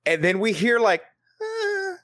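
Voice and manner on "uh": a high, soft imitated moan of a woman; woman's moan. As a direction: breathe out a faint high ghostly moan. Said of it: "like a woman's voice".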